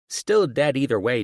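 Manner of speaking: put-on high, loud kid comeback; retorting daughter. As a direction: shoot back at Dad like a cheeky child.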